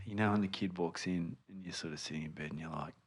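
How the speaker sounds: very soft hushed storytelling. Real, not acted. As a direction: keep it barely above a murmur. Bedside, child asleep nearby.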